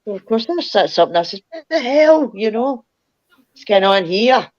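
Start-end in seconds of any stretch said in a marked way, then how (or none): 1.5-4.5 jolted awake, much higher, a bit louder; sit bolt upright and demand what's happening; startled. Retold or imitated. Woken by a touch on her shoulder, startled and much higher.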